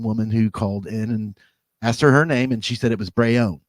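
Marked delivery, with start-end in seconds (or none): 3.1-3.6 "Brayone", Brown stretched into two Southern syllables; imitated Southern "Brayone" for Brown; Southern vowels. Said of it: "That's Southern".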